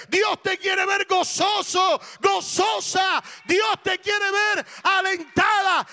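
loud, high, drawn-out preacher's shout; full-force preaching. Real, not acted. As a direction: build into a ringing pulpit proclamation.

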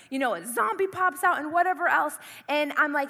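ordinary delivery

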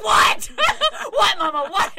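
loud and high, laughing mid-yell; snapping back. Retold or imitated. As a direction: bark it at your mom. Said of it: "And I'm like".

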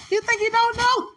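very high, loud squealed question; high-pitched excited echo of a quote; incredulous exclamation. Acted. Repeats God's teasing question, overcome.

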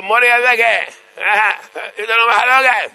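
put-on high, loud call to the old rabbi; mock urgent shouting. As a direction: plead the dispute at the top of your voice. Congregants calling out to old rabbi.

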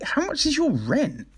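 high, disbelieving question; incredulous question. Retold or imitated. Retelling asking the scam friend her rent, high and incredulous.

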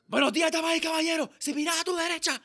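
high, loud, fast Spanish yell; screamed announcement. Acted. Cabrón pilot addressing his passengers, screaming. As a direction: bellow the cabin announcement at full scream.

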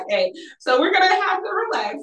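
high, quick telling-off of mom; mock placating. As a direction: replay calming an over-critical mother, pitch way up. Acts answering mom's ring jibe.

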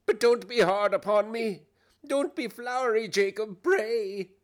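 high, loud, anxious plea; nervous pleading. Acted. Scrooge begs Jacob not to be hard, pleading.

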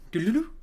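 short high trilled call; little trill. Acted. Game master voices the closing flourish, little trill. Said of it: "like a little trill".

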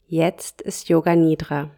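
normal talking voice

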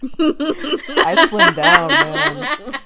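slow, high, laughing brag. Real, not acted. Guy bragging about losing weight, laughing slowly. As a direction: stretch it out through laughter.

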